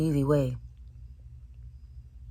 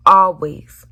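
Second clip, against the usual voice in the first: loud, firm; loud emphatic; 3 semitones higher, 11 dB louder than usual. Real, not acted. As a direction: belt it out. Emphatic single word.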